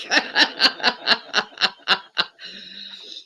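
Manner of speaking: long staccato chuckle in even bursts; real laughter. Real, not acted. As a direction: laugh it out in a steady run of pulses. Laughing at the prank retold.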